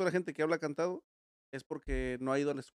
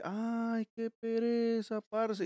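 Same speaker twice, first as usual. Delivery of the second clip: higher, very slow, lazy paisa drawl; Colombian paisa accent; 7 semitones higher, much slower than usual. Retold or imitated. S0 mocks how people from Medellín talk, in a slow paisa drawl. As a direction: imitate a bored Medellín local, much higher and slow. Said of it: "cuando alguien te dice".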